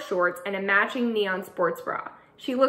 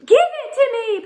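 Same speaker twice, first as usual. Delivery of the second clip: put-on high, loud child's demand; high shouted kid voice; boy yelling; 7 dB louder than usual. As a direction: grab it back, kid. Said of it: "Patrick yells".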